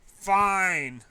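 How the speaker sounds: loud, high snap of a reply; a sudden loud huffy fine; sharp retort. Acted. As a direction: fling the word back at her. Snapping back at friend.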